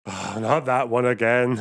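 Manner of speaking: ordinary talk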